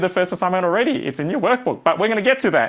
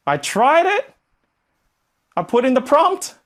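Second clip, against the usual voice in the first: whiny complaint; 6 semitones higher than usual. Retold or imitated. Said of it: "you say".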